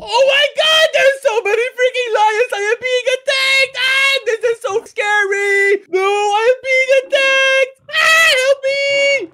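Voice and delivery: high, loud panicked cries; panicked screaming. Acted. Attacked by lions, panicking.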